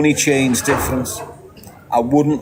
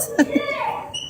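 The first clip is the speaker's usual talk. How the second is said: laugh; 7 dB quieter than usual. Real, not acted. Laughs at the absurd advice.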